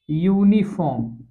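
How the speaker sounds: यूनिफॉर्म drawn out slowly, syllable by syllable; slow pronunciation demo. Shown as a demonstration. Teacher slowly modelling यूनिफॉर्म for class. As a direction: stretch out यूनिफॉर्म so every sound is heard. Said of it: "ये तो उच्चारण".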